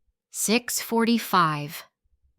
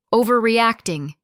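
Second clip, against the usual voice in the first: a louder, bitter echo of "Overreacting"; incredulous repeat; 4 semitones higher, 7 dB louder than usual. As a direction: throw "Overreacting" back at him. Angry echo of his word.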